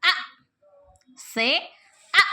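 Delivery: drawn-out short vowel आ said slowly; short a sound demo. Shown as a demonstration. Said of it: "ए का short sound क्या आ जाएगा?".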